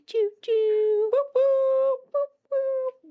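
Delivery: high, slow choo-choo hoots; train whistle imitation. Host showing how trains sound, high whistle imitation. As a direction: hoot far above the speaking voice like a toy train. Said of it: "But I could see, like, and then".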